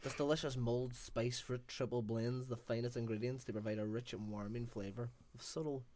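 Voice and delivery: lightly voiced ad read; label read. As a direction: read the label like a mild product blurb. Reads the cider label.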